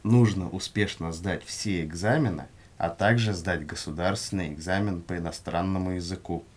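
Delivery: ordinary talk, nothing marked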